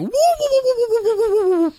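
very high, rapid cartoon whooping; Doctor Zoidberg whooping. Mimicking a cartoon doctor with arms overhead, high whooping. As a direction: whoop like a frantic cartoon creature. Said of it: "Doctor Zoidberg going".

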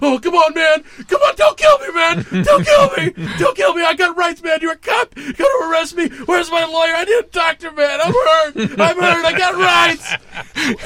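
high, loud panicked whine; whining begging. Retold or imitated. Wounded killer begging the cop, high panicked whine. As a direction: plead for your life like a cornered coward. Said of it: "he's like".

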